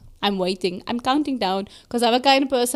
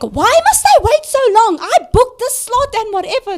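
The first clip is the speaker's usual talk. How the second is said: high, loud entitled Karen whine; mock Karen accent demanding to know why she waits; put-on Karen accent; 10 semitones higher, 4 dB louder than usual. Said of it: "Now I'm doing the accent".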